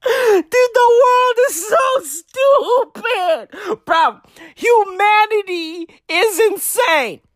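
incredulous, half laughing; exasperated disbelief. Real, not acted. Ranting to viewers in disbelief, high and laughing.

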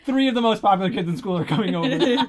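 loud, high plea through laughter; hammed-up kid begging the parents, half laughing; mock pleading. Acted child pleading with parents.

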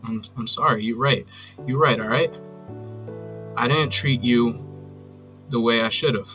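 low, halting apology with long pauses; subdued, contrite voicemail plea; remorseful pleading. Acted. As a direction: beg for another chance, searching for words.

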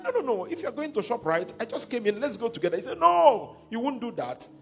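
a lightly voiced pushy host; eager insisting. Host insists politely on a visit.

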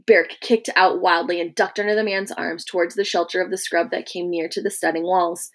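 usual speaking voice

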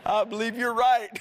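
amused, laugh bubbling through the words. Real, not acted. Preacher agreeing with the joke he just told, laughing. Laughing at his own anecdote.

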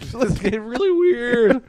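high, slow cartoon whine; Meeseeks voice. Echoing a friend's Meeseeks quote, high drawn-out cartoon voice.

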